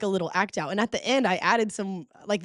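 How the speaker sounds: usual speaking voice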